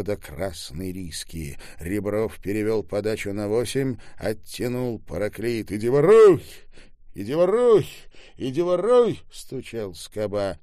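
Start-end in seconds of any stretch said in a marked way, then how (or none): 5.7-9.2 loud, high chant-like yelling; shouting through a door. A narrator's reading. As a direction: hammer out each repeated shout. Shouting while banging on a door. (настойчивые громкие выкрики в дверь)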